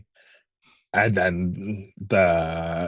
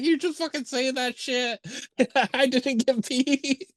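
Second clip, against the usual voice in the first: crying alien Rocky. Retold or imitated. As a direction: squeak out the alien's tearful protest through laughter. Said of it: "He's like".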